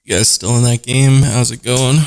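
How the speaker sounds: loud call across the room. Real, not acted. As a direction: call out to the others loudly. Calling out to other players.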